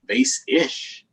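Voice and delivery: put-on lively read-aloud for young kids; story read to children. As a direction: read the picture book with animated voices. Reading picture book aloud to children.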